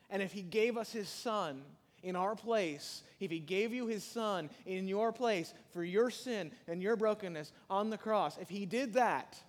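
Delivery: high, loud and slow preaching; full-force preaching. Acted. Preacher to congregation about the cross, full-force preaching. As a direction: pound the point home from the pulpit.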